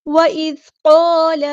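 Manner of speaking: slow, drawn-out chanted recitation; tajweed chant; Quranic recitation. Shown as a demonstration. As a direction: recite it melodically, stretching each sound. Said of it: "recites Quran verse in tajweed".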